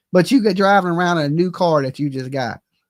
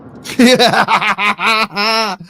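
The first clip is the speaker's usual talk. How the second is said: a loud, mocking laugh; scornful laugh; 3 semitones higher, 5 dB louder than usual. Real, not acted. Laughing at the man's claim of liking people. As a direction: laugh at the obvious lie.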